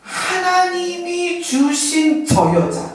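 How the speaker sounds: high, slow, whiny blame; Adam blaming God. Retold or imitated. Adam answering God, blaming Eve, high and slow. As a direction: drag it out like Adam pointing at his wife. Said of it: "아담이 뭐라 그래요?".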